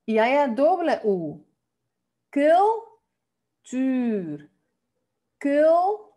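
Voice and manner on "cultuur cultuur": cultuur, loud, higher, short first syllable, long rounded second; model pronunciation. Shown as a demonstration. Teacher drilling the two U sounds in "cultuur", loud split syllables. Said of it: "una U con doble consonante".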